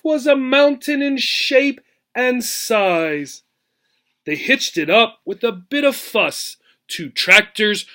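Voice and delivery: lively storybook read-aloud, rhythmic and bouncy; expressive picture-book reading; reading to children. Acted. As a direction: read the rhyme to a class with relish. Teacher reads picture book aloud.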